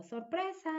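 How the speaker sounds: high, bright "¡Sorpresa!" call; spring the surprise on them; cheerful surprise. Shown as a demonstration. Woman surprises someone, high excited call.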